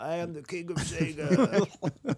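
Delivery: slow, high booming megaphone proclamation; dark lord. Pretend. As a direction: proclaim it through a megaphone like a king. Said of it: "with a big megaphone".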